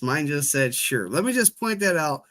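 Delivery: ordinary delivery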